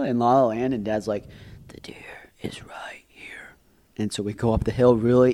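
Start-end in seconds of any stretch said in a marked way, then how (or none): 1.7-3.5 very soft, slow whisper; stealthy whisper. Acted. Dad whispers to child that deer is close, hushed. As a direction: whisper it so the animal won't hear.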